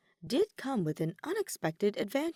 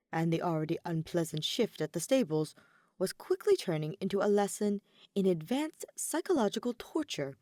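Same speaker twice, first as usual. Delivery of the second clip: ordinary delivery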